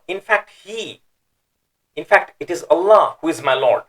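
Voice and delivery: HE high, ALLAH hit hard and loud; emphatic. Shown as a demonstration. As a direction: insist on it, punching Allah. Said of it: "that emphasis is coming about".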